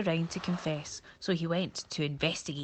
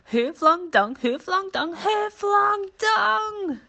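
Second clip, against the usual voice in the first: rising higher and louder each time, the last long and stretched; building shout; 8 dB louder, slower than usual. Acted. Monkey shouts from the giraffe's neck, building to a high wail. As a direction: climb to a frantic wail.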